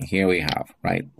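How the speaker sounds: ordinary talk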